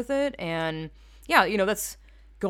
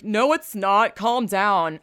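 mocking dismissive commenter; 3 semitones higher, 6 dB louder, much slower than usual. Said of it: "you're like".

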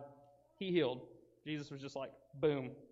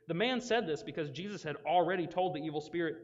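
usual speaking voice

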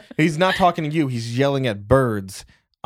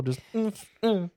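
ordinary delivery

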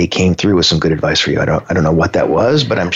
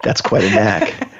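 laughing. Real, not acted. Host reacting to co-host's innuendo, laughing. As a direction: laugh through the reply.

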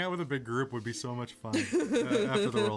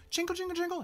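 jingling sound; faster than usual. Acted. Imitates the device sound.

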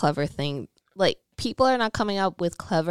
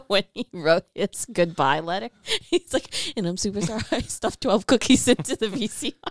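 giggling through the quote; laughing while quoting; 2 semitones higher than usual. Real, not acted. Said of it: "laughing now".

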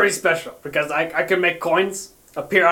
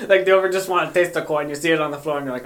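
ordinary talk, nothing marked

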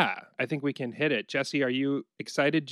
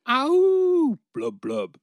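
goldfish voice; 12 semitones higher, 6 dB louder, much slower than usual. Pretend. Playful fish voice bit.

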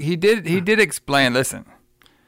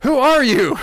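much higher, exclaimed question; mock alarmed cry; 11 semitones higher, 4 dB louder than usual. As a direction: act out a bewildered hero calling out. Acts out Mark's alarmed question.

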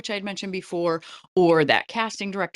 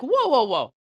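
high, louder burst of mock astonishment; mock surprise; 8 semitones higher, 6 dB louder than usual. Viewer hears an actor's real accent in an interview, mock surprise. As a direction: imitate a viewer caught off guard.

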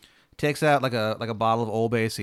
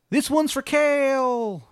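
high, long-drawn Maryland cry; Baba Yaga; 13 semitones higher, much slower than usual. Acted. Baba Yaga calling as she flies away, drawn-out Maryland accent. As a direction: stretch every word in a thick Maryland accent. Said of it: "it's hard to understand her".

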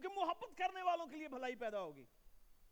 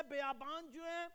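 ordinary talk

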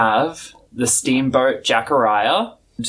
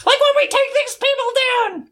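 much higher, chuffed then eager; Old Smoky. Acted. Old Smoky thanks the player, high chuffed delight. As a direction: gush thanks, then relish the fight.

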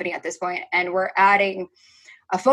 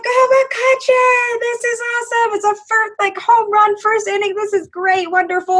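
acted-out home-run cheer; 12 semitones higher than usual. Fan cheering McCutchen's home run in wrong section, elated. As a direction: cheer way up high, easing down gradually.

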